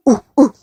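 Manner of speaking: short punchy ape hoots; gorilla hoots. Pretend. Parent showing a child the gorilla's noise, hooting. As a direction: hoot twice like a gorilla.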